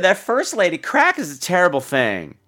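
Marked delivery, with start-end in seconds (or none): none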